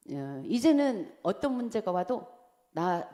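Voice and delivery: normal talking voice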